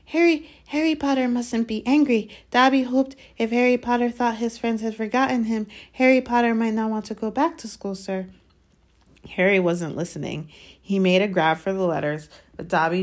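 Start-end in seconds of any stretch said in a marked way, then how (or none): 0.0-8.2 high, anxious house-elf pleading. Acted. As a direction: beg nervously as a small elf. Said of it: "Dobby blinked anxiously".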